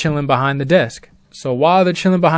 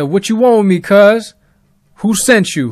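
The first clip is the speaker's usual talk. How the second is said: higher, louder retold demand; confrontational challenge; 6 semitones higher, 7 dB louder than usual. Leon challenging the unknown caller, loud and confrontational. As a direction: imitate a friend squaring up to a caller.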